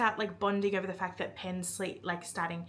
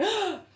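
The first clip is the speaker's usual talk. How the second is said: very high, bright gasp; Scarlett's gasp; 11 semitones higher than usual. Retold or imitated. Scarlett hearing his confession, a high gasp. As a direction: gasp as the swooning heroine. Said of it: "Scarlett's like".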